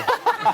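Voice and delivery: high, loud laugh; burst of laughter. Real, not acted. Host cracking up at a guest's joke, high laugh. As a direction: let a sharp laugh burst out.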